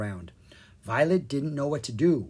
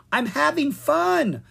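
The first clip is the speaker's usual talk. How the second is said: put-on high, slow little girl; dreamy spellbound child; 13 semitones higher, 5 dB louder, slower than usual. A narrator's reading.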